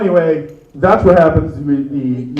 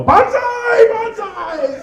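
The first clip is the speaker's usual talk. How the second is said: yelled banzai; much slower than usual. Retold or imitated. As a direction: yell it like a charging soldier. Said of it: "and yell".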